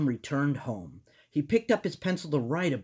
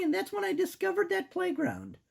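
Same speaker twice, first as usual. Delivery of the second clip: voice cracking. Acted. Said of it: "Your voice cracked there".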